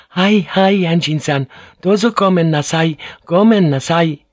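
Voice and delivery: high-pitched, hurried-to-please woman's apology; flustered apologetic housekeeper. A narrator's reading. Housekeeper to Anjin-san about the pheasant, apologetic. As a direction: raise the voice high and apologize earnestly.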